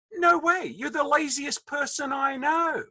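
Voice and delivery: high, drawn-out mock outrage; exasperated dad. Dad turning down his teenage son, mock exasperation.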